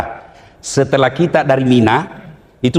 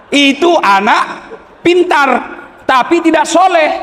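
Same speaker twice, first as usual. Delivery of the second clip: emphatic verdict; 12 semitones higher than usual. Real, not acted.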